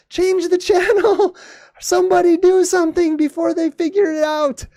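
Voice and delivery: high-pitched, escalating frantic yelling, stretched at the end; hammed-up panic, pleading at a very high pitch; mock panic. Broadcast crew begging to switch the camera, mock panic.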